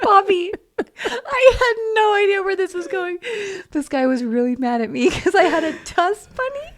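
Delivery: real laughing disbelief. Real, not acted. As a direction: laugh your way through it.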